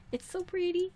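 small, high, soft voice; high soft cooing. Real, not acted. Admiring the pretty fountain, high soft cooing. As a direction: coo it softly, way up high.

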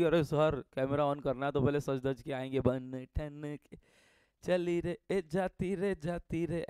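lightly mock a girl fussing over makeup; imitating girls. Teacher mocking girls primping before camera, lightly coy. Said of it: "लड़कियां तो पहले makeup करने जाएगी".

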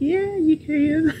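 high, slow, giggly yes; squealing with delight while agreeing; laughing. Retold or imitated. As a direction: stretch it out through a laugh. Her giggly high reply.

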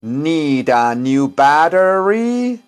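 very slow; drawn-out "battery"; slow model phrase. Shown as a demonstration. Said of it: "Repeat after me". (frase modelo muy lenta)

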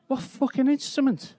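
high-pitched mock disbelief; incredulous retort. Comedian answers a question about his instrument, incredulous and high. Baffled retort in retold exchange.

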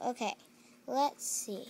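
ordinary talk

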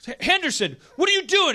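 high, loud furious boss shouting a name; angry boss yell. Acted. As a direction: yell at your employee like a boss. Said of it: "his boss goes like".

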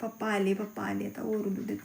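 soft hushed voice, dad is coming; hushed warning. Real, not acted. Mother warns child dad is home, hushed.

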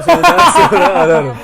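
high squeaky laughing line; cracking up mid-sentence. Shown as a demonstration.

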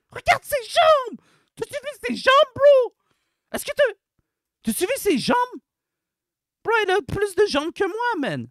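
high-pitched, loud indignant rant; outraged disbelief. Real, not acted. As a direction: exclaim in escalating horrified disbelief.

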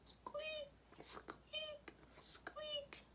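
tiny, very high, soft squeaks; soft squeaky voice way up high; little squeak. A narrator's reading. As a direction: squeak it like a mouse. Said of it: "he heard a little noise".

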